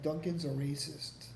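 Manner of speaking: ordinary talk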